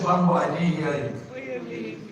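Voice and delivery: slow, firmer call to the crowd; insistent repeat. Real, not acted. Preacher calls the congregation for a response, insistent repeat. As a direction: press them for a response.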